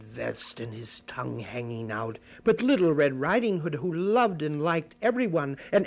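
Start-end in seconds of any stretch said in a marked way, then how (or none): none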